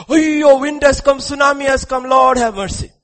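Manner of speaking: high, loud mock wail of terror; panicked outcry. Terrified masses beg the Lord for mercy, hammed-up panic. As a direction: cry out like a frightened crowd.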